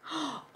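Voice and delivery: high, quick intake of breath; excited audible gasp; surprised gasp. Real, not acted. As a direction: gasp at a happy discovery. Discovers link to course.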